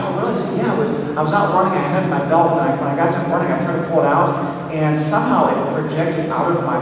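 ordinary talk, nothing marked

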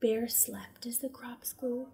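slow, soft whisper; hushed whisper. A narrator's reading. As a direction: whisper as if not to wake him. Narrating sleeping bear softly.

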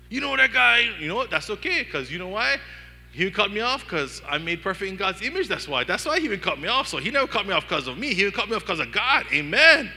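preaching at full force. Real, not acted. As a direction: fire up the congregation to a cry. Preacher's rising climax, shouting.

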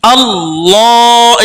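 আল্লাহ with লা stretched one alif; drawn-out chant on আল্লাহ; tajweed recitation. Shown as a demonstration. Teacher demonstrates the stretch, slow chant. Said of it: "এই আলিফ এই লামের আগে ধরবে".

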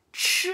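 Ch with the same curled tongue plus a puff of air; aspirated ch. Shown as a demonstration. Teacher showing the breathy Chinese ch. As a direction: push air out on Ch. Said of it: "la misma posición, pero expulsando aire".

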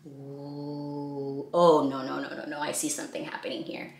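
low hushed uh-oh; hammed-up concern; mock worry. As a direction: build suspense for the kids. Dramatic worry in story.

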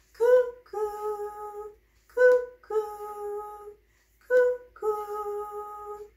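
high, loud cuckoo call; bright high cuckoo for the baby. Acted. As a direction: sing out like a cuckoo clock. Cuckoo clock strikes.

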